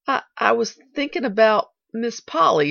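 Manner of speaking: hesitant, halting start; faltering. A narrator's reading. Nancy flushed by a compliment, faltering. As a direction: stumble into an awkward reply. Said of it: "faltered Nancy".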